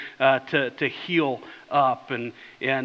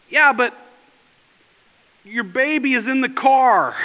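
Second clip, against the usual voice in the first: higher and louder, insistent; exasperated call; 7 semitones higher, 5 dB louder than usual. Retold or imitated. Pleading with the careless father, higher louder insistent call. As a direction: press the point again. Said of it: "And I thought".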